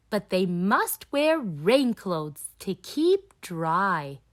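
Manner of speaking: plain reading voice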